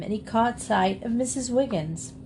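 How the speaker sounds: plain reading voice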